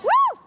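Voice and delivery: high, loud joyful whoop. Real, not acted. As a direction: cheer them on with a loud woo. Cheering the class on.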